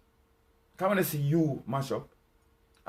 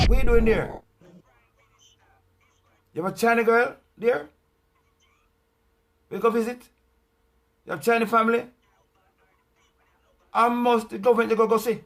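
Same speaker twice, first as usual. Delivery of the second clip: louder, higher rapid-fire accusing questions; loud interrogation; 6 semitones higher, 5 dB louder than usual. Acted. Grilling the politician about his China trips, loudly. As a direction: grill him, voice raised.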